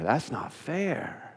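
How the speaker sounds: quiet, slow, drawn-out "That's not fair"; soft, slow sulky complaint. Shown as a demonstration. Preacher voicing the listener's grievance, soft and slow. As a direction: mutter it softly and slowly like a hurt child. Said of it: "and what is that?".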